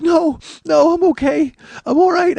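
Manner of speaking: very high, loud tearful squeal; hammed-up reassurance, voice pitched sky-high and loud; mock laugh-crying. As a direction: imitate talking through laughing and sobbing. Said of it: "laughing and crying at the same time".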